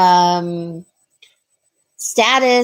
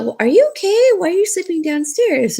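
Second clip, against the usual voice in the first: mock worried tenant; 9 semitones higher, faster than usual. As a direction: play the puzzled tenant asking with exaggerated concern. Tenant's worried question, retold.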